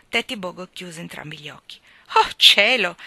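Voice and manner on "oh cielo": loud, startled exclamation. A narrator's reading. Fiona reacts to her friend's confession, exclaiming loudly. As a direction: burst out loudly on the "oh". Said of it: "esclamò Fiona".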